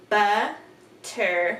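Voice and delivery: slow "butterfly", a gap between "but", "ter", "fly"; split into syllables. Shown as a demonstration. Teacher asking for the syllable count, word split up. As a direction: sound out "butterfly" chunk by chunk. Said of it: "How many syllables are there in the word".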